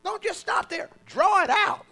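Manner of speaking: high, loud preaching, stretched out; full-force preaching. Acted. Preacher urging the congregation, at full force. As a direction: preach it out to the church at full power.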